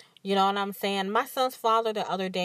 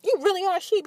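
higher and louder, punching "You" hard; exasperated retort; 11 semitones higher, 4 dB louder, much faster than usual. Retold or imitated. Telling off her son's father, louder exasperated scorn. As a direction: blurt "You really are a sheep" in exasperation. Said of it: "in my head, I'm like".

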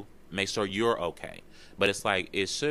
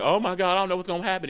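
much higher, louder anxious cry; a scared oh my God, pitched way up; panicky worry; 11 semitones higher, 6 dB louder, much faster than usual. Retold or imitated. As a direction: voice someone spiralling into fear. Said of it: "'cause we like".

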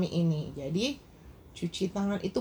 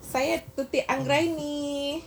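repeated louder and higher; 5 semitones higher, slower than usual. Real, not acted. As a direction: speak up, closer to the mic. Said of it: "Suara Bu Dokter masih pelan".

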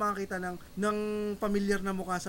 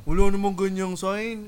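loud, slow, dopey commenter voice; mocking basher; 8 dB louder than usual. Comedian mimics literal-minded basher denying the sign, mocking. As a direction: drag it out loudly with rounded "o" vowels. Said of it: "yung mga basher".

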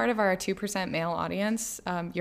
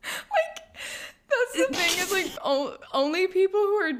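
high, squeaky talk through laughing; real giggles pushing the voice high; helpless laughter; 11 semitones higher than usual. Real, not acted. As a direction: struggle to speak while cracking up. Cracking up over the accent.

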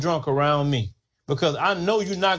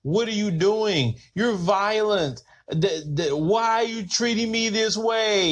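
higher, slow, wide-eyed mock outrage; mock innocent victim; 5 semitones higher, slower than usual. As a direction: play the hurt innocent, pleading slowly. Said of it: "they wanna act like".

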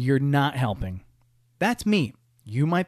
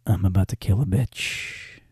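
slow, lowered mock threat; low menace; 5 semitones lower than usual. Husband voices his wife's snapping thoughts, in low, slow menace. Voicing wife's silent fury.

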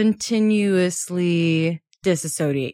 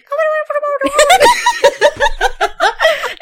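squeaky high underwater gurgle; 6 dB louder than usual. Retold or imitated. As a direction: mimic garbled underwater audio. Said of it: "our audio sounds like we're underwater".

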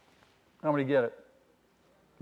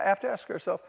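ordinary delivery

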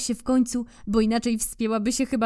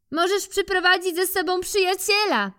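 squirrel; 12 semitones higher than usual. Acted. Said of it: "dodała".

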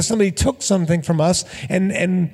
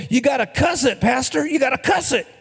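put-on higher-pitched kid voice, earnest advice; little boy voice; 8 semitones higher than usual. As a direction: play the little kid telling the pastor what works. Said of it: "And the little boy says".

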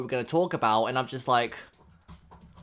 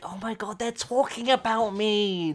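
imitated fan excitement; 7 semitones higher than usual. Listener hearing her question on the podcast, mock thrilled squeal. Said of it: "you're gonna be like".

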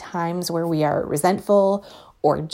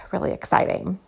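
ordinary delivery

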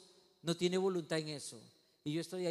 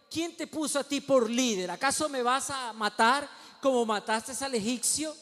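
raised, higher accusing voice; confrontational challenge; 6 semitones higher, 7 dB louder than usual. Acted. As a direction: throw it as a loud challenge. Acted challenge from Moses's accusers.

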